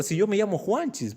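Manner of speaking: put-on high-pitched outraged little boy; furious child. Boy angrily telling his mother his real name, high. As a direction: insist in full childish fury. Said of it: "con todas las iras".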